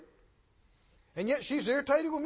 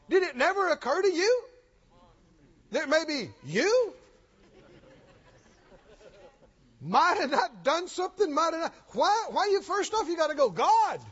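higher, rising rebuke; preaching at force; 5 semitones higher, 4 dB louder than usual. Acted. Preacher rebuking the proud congregation, fervent and loud. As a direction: drive it home like a fiery preacher.

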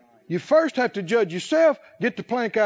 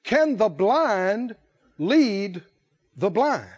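slow, weighty reading of Can the blind lead the blind; slow scripture reading; much slower than usual. Shown as a demonstration. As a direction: draw out each word of the verse slowly. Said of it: "And he spake a parable to them".

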